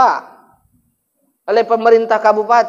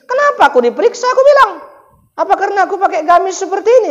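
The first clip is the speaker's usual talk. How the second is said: indignant protest; 9 semitones higher, faster than usual. Retold or imitated. Preacher retells protesting the check.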